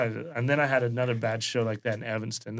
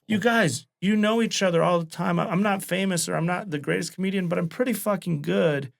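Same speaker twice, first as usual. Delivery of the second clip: 1 retold angry rant; 7 semitones higher than usual. Scolding talkative audience.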